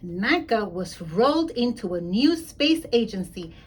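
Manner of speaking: usual speaking voice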